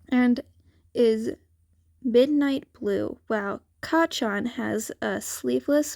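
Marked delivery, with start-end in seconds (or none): none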